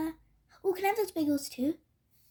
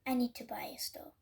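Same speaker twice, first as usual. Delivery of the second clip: urgent unvoiced whisper; 4 semitones lower, 6 dB quieter than usual. Cat whispering to Hedgehog about buying the store. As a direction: hiss it secretly.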